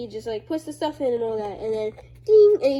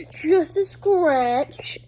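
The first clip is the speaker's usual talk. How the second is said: slow tough-guy shrug; 5 semitones higher, 8 dB louder, much slower than usual. Retold or imitated. Character shrugs off wound.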